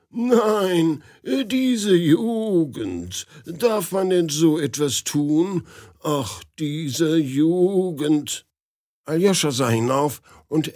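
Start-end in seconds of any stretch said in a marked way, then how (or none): 0.1-8.5 put-on high, slow old man's voice. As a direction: drawl it like a fussy old gentleman. Old man scolds the youth.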